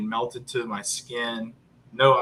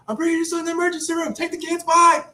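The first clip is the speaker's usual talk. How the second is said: high, rapid-fire, ending in a loud "bye"; frantic rush; 6 dB louder, faster than usual. Retold or imitated. Wife dropping kids at grandparents en route to ER, frantic. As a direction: imitate someone blurting orders on the run.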